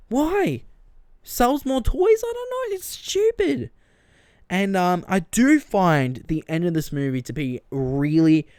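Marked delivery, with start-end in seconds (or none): 0.1-3.7 high-pitched exasperated disbelief; squeaky, fed-up sarcasm; incredulous. Real, not acted. Mocking the studio's motive, a high incredulous whine. Ranting about toy sales.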